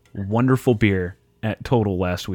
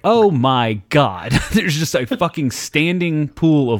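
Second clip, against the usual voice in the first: stunned; 6 semitones higher, 3 dB louder, slower than usual. Retold or imitated. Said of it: "I was like".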